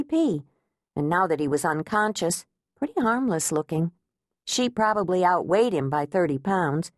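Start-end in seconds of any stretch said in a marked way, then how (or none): none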